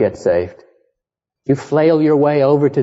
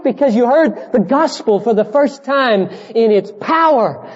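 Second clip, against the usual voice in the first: high, urgent sermon climax; impassioned preaching; 11 semitones higher than usual. Real, not acted. Preacher urges congregation to wake up to the gospel, impassioned. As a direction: plead with them to wake up.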